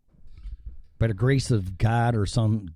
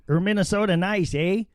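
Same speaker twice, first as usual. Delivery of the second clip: higher-pitched mock Minnesota accent; 7 semitones higher than usual. Host explains the U P, mock Minnesota accent. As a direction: lightly put on a Minnesota accent with "eh". Imitates Upper Midwest talk.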